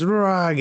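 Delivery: high, drawn-out mock "raargh"; mock yell of pain; imitated pained roar. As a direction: cry out high, as if just stabbed. Imitates character's cry when stabbed.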